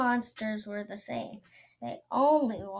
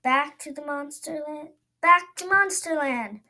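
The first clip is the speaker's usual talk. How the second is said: rising, louder back-and-forth; arguing back; 4 semitones higher, 7 dB louder than usual. Acted. Princess and monster arguing, louder. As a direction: bicker ever louder. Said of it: "it said".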